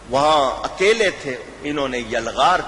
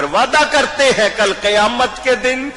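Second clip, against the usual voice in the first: impassioned promise; 9 semitones higher, 4 dB louder than usual. Acted. Preacher voicing Imam's promise.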